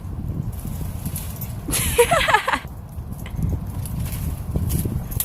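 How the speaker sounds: long breathless laugh, way up high; can't stop laughing at the dogs; high airy laugh. Real, not acted. Owner cracks up watching the dogs, high and airy.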